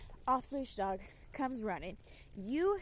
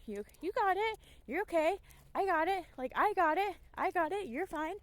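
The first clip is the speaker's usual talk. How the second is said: higher, louder reassurance to the dogs; calm your dogs down, "I got it"; reassuring your own dogs; 6 semitones higher, 4 dB louder than usual. Shown as a demonstration. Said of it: "let your dogs know".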